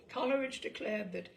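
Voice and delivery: plain reading voice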